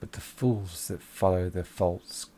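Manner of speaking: low, slow, scornful menace; sinister voice. Acted. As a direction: sneer at the fools from the dark. GM voices the dark presence.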